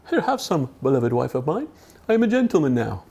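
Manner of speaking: lightly put-on English gentleman accent; mock gentry accent; posh gentleman. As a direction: offer it grandly like a toff. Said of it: "I meant to be a gentleman".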